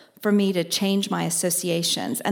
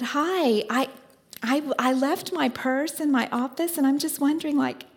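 higher, sweetly helpless voice; sweet helpless ask; 6 semitones higher than usual. Retold or imitated. Asking a passing woman for money, higher sweetly helpless voice. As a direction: play the stranded lady asking for cash.

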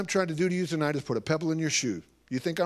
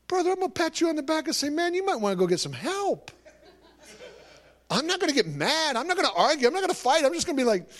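much higher and louder, building fervour; fired-up pitch climbing higher; rising excitement; 12 semitones higher, 4 dB louder than usual. Real, not acted. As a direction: preach it up with rising passion.

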